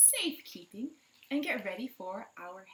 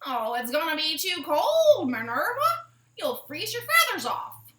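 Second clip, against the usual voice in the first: high, loud fretful hen chorus; worried chicken friends; 6 semitones higher, 10 dB louder than usual. Acted. Chicken friends fussing at Minerva, high and loud. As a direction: warn her off like fussing hens.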